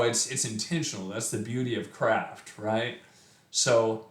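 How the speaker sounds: usual speaking voice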